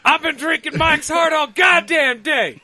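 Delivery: high shout. Pretend. Tipsy shout about drinking.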